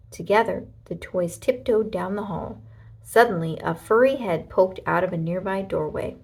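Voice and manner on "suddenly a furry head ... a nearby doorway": ordinary delivery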